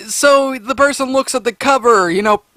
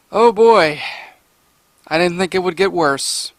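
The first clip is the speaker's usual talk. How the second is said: ordinary talk, nothing marked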